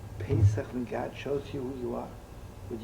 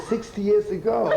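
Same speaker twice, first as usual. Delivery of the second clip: high, loud, with laughter breaking through; laughing punchline; 6 dB louder than usual. Retold or imitated. Old woman answering the police, laughing high and loud.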